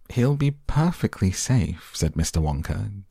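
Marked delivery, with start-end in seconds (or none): none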